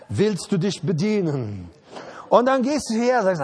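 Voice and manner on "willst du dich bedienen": slow, lower, coaxing voice of the open handbag; tempting handbag. Acted. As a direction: lure the listener like a talking purse. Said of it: "Und sie sagt". (verführerische Handtasche, langsam und tiefer)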